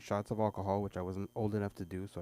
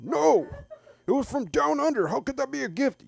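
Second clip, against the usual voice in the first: mock whiny kid; 11 dB louder than usual. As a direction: whine it at dad. Son voice.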